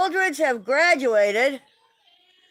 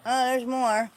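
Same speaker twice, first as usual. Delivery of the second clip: ordinary talk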